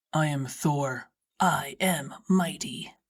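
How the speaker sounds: low, slow hero voice, last words whispered; mock mighty boast. A narrator's reading. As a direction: puff up like Thor, then a whisper. Drew boasting while carrying Martha.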